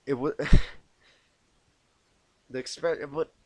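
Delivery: breaking into real laughter mid-line; laughing. Real, not acted. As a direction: crack up while reading.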